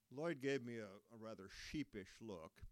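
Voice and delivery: plain reading voice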